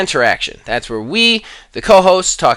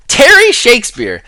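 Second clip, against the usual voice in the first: the name again, much higher and louder; higher repeat; 10 semitones higher, 4 dB louder than usual. Shown as a demonstration. Host calls out a fan name again, higher and louder. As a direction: say Terry Shakespeare again, high and announcing.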